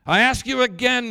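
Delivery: loud, high shouted challenge; screamed demand. Acted. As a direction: shout it as a furious officer's demand. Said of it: "he screamed".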